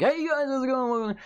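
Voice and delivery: high, bubbly YouTuber greeting; chirpy hello to the viewers; entertaining YouTuber voice. Shown as a demonstration. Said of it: "the entertaining YouTuber voice".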